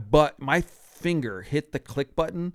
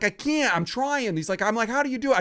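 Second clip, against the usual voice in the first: flustered panic; 8 semitones higher than usual. Retold or imitated. Panicked reply to colleague.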